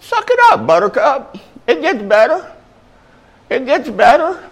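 high put-on coaxing voice; high-pitched mock encouragement; teasing pep talk. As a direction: tease it out in a high voice.

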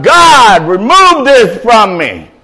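loud, high plea to God; acted cry of pleading. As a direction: cry it out, drawing out the words. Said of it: "and he cried out".

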